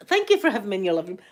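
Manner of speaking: quick, even repeat of the thanks; brisk thanks. Real, not acted. Host thanking viewers for having her in, brisk. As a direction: say it briskly in your everyday pitch.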